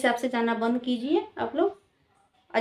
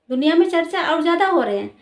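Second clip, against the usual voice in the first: exasperated scolding; 5 semitones higher, 6 dB louder, faster than usual. Real, not acted. Scolding the media.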